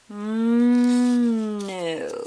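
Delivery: a drawn-out hummed mm, then a flat no; stubborn refusal. Acted. Thomas turning down his mother, loud stubborn hum. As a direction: dig in your heels and refuse.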